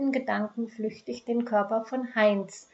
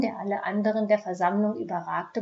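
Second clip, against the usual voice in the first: ordinary delivery, nothing marked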